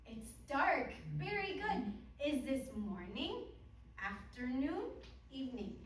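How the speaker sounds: over-enunciate for beginner English learners; kids show host. Shown as a demonstration. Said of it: "But I want to do one with you".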